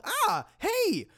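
high startled yelp. Acted. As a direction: yelp as the blade swings. Nearly loses arm.

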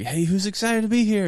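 high, loud hype call to the room; hyped crowd greeting. Retold or imitated. Youth pastor hyping a flat crowd, loud and high. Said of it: "we're like".

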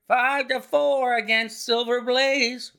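loud, high, drawn-out ring call; roaring bookmaker. A narrator's reading. Bookmakers calling the odds at the racetrack, roaring. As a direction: roar the odds out across a crowd. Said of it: "roared the ring".

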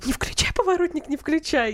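mock warning. Voice in the head warns.

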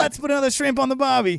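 put-on Aussie accent, pitched high; hammed-up Australian catchphrase voice. Shown as a demonstration. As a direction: do a stock Australian impression. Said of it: "Can you do an Australian accent".

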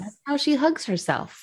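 shrugging, offhand delivery; matter of fact. Retold or imitated. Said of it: "very, like, matter of fact, almost like with the shrug".

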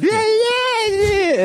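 high whiny yelled yeah; pinched nose impression. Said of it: "that's what it sounds like".

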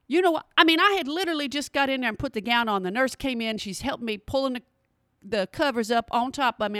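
normal talking voice

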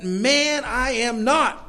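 high, indignant protest; emphatic denial. Acted. Peter denying Jesus to his accusers, emphatic and high. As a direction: raise the pitch sharply and protest it. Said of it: "made sure everybody heard it".

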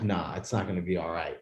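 low, hushed "nah"; quietly retold sense of doom; resigned retold disbelief. Retold dread, expecting the worst.

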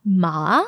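"ma" low, dipping then rising; third tone. Shown as a demonstration. Teacher giving the Chinese word for horse, third tone. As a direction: let "ma" sink low and come back up. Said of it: "the third tone".

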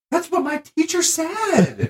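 very high, drawn-out mock wail; high-pitched protest. Retelling a defence to a laughing audience, high mock protest. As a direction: squeak out a slow, defensive protest.

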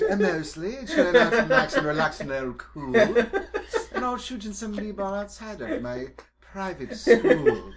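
a lightly laughing put-on British accent; sudden British accent. Player slips into a British accent describing his character, laughing. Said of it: "Why am I suddenly British?".

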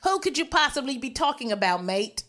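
playful mock-English accent; hammed-up English accent. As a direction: feign innocence in a put-on English accent. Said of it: "doing this English accent".

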